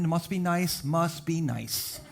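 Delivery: envious aside. Real, not acted. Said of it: "envious aside".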